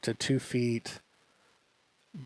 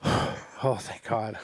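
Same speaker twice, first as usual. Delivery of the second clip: retold relief; 2 semitones lower, much slower than usual. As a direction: sigh it out, voice dropping away. Relief as badgers leave, retold.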